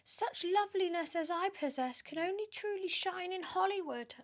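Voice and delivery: put-on preen like a vain, fat old aunt; high, silly show-off voice; vain Aunt Sponge.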